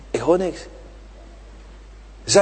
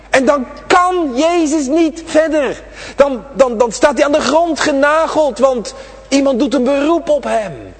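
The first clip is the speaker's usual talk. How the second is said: raised, ringing sermon voice; fervent preaching; 7 semitones higher, 4 dB louder than usual. Acted. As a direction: preach it out to the children, high and carried. Preaching about prayer.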